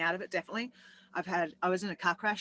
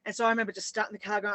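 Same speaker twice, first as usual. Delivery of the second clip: ordinary talk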